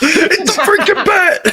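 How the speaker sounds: high, slow, laughing goon yelp; Arkham goon. Retold or imitated. Mocking Arkham goons, laughing high-pitched goon cry. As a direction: squeal it like a panicked henchman. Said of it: "goons ... Arkham City".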